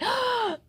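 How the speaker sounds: high, wide-eyed gasp of delight; sharp high gasp of surprise; big gasp. Acted. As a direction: gasp as if the taste surprised her. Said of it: "opened her eyes wide".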